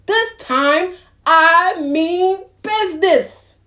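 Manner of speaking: slow, loud and stern; firm angry duck. Acted. Mister Duck warns the animals, slow, loud and stern. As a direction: lay down the law slowly. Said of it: "cried Mister Duck".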